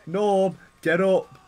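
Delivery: high, loud, exasperated shouting; urgent yelling. Real, not acted. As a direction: yell at a stuck character to move. Calling to stuck game character.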